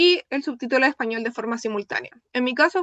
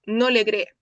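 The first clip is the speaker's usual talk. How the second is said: usual speaking voice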